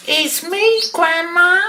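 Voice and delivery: put-on high child voice calling out; little girl. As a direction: pipe up as a young visitor. Child answers at grandma's door.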